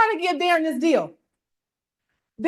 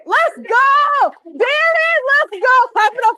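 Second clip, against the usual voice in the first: hype shout; 7 semitones higher, 6 dB louder, slower than usual. Real, not acted. Hyping a volunteer at job fair.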